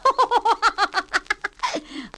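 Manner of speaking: high carefree laugh; lazy pigs laughing. Acted. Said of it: "laughed the two lazy little pigs".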